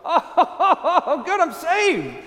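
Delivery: high, laughing relief as the lamb; relieved laugh. Acted. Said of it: "And Frederick thinks to himself".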